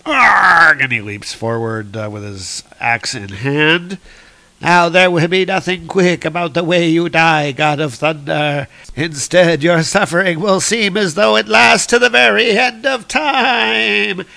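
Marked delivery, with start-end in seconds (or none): 0.1-0.8 loud, high charging roar; battle cry. Acted. Thor leaping forward with axe, loud roar. 4.6-14.2 raised, loud villain threat, building; God Butcher. Acted. Villain promising Thor endless suffering, rising theatrical menace.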